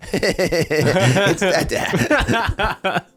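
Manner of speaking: put-on high, slow childlike voice mid-laugh; a small son's gleeful cry, laughing; imitating a little kid. Son recognizing dad's Gollum voice, high and laughing. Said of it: "he was like".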